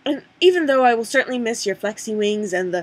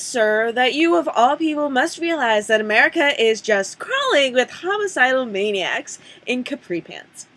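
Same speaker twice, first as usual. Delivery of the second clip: usual speaking voice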